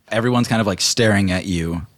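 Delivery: ordinary talk, nothing marked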